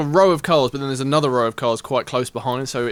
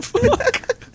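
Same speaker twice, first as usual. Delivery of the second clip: laughing curse. Retold or imitated. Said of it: "I was just like".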